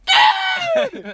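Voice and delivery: very high, loud squeal of joy; excited shout. Retold or imitated. Grandson reacting to the skull grandfather found, excited shout. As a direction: shriek it out with delight.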